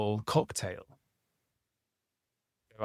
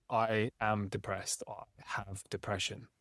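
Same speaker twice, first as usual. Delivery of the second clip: much softer, slowed, sinking; flat, heavy self-label; 10 dB quieter than usual. Shown as a demonstration. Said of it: "Completely different".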